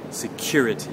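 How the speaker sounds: "security" with the CURE syllable stressed; stress on second syllable. Shown as a demonstration. Teacher modelling a word for learners, second-syllable stress on "security". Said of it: "stress now is on the second syllable".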